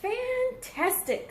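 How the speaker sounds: high, slow, gushing "Fantastic"; excited praise. Acted. Princess cheering on the boastful dragon, high and delighted. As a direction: stretch it out in delighted wonder.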